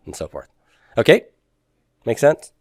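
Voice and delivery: normal talking voice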